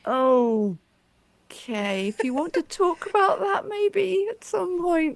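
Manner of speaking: high-pitched, drawn-out careful dad; imitating uneasy dad. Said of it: "my dad was like".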